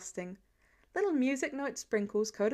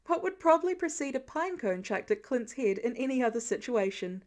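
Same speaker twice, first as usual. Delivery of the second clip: ordinary delivery, nothing marked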